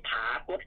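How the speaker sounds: ผากฏ starting on an airy "ph", long "phaa"; aspirated variant. Shown as a demonstration. Teacher lists an odd variant seen in print, ผากฏ with aspirated start. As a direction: aspirate the first sound of ผากฏ. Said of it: "แตกต่างกันออกไป".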